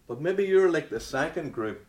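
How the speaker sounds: usual speaking voice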